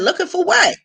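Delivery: high-pitched incredulous comeback; incredulous question. Retold or imitated. Daughter questions mother's odd search, incredulous. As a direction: question Mom in rising disbelief.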